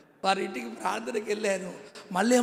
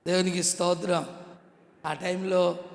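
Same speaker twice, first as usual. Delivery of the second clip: ordinary talk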